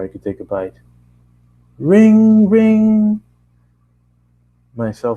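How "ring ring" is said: high, loud sung ring ring; shrill-pitched ringtone imitation; mock phone ring.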